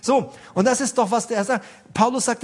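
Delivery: ordinary delivery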